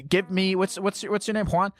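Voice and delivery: ordinary talk, nothing marked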